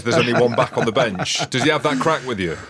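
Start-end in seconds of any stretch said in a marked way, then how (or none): none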